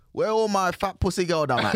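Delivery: high, loud Jamaican DJ shout-out. Retold or imitated. Friend imitating an old school Jamaican DJ's shout-out, loud Jamaican accent. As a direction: call out to the crowd in a Jamaican DJ voice. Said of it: "old school Jamaican D J".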